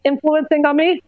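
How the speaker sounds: words round a sip of beer; while sipping a drink. Real, not acted. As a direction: talk between sips of beer. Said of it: "I'm drinking beer right now".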